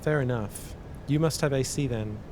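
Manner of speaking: usual speaking voice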